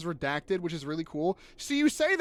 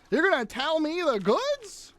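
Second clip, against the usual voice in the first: slow, disbelieving, pitched high; can't believe you'd dare; higher and slow with indignant disbelief; incredulous; 8 semitones higher than usual. Real, not acted. Streamer scolding imagined spoiler chatter.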